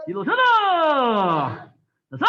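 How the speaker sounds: loud Korean count called to the class; shouted count. Real, not acted. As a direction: bark the count so the whole room hears. Instructor calling turn command.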